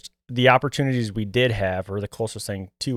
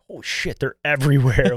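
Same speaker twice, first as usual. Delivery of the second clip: surprised; 4 semitones higher, 4 dB louder than usual. Retold or imitated. Surprise at deer appearing.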